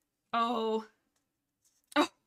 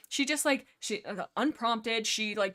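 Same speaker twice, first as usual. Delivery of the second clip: ordinary delivery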